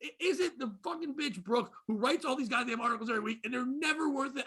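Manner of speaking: ordinary talk, nothing marked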